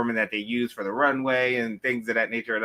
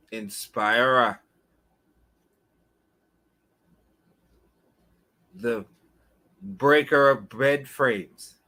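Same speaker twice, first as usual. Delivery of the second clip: hype announcer intro. Pretend. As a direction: build him up like a champion. Hype-man introduces the host.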